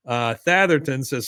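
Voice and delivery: ordinary talk, nothing marked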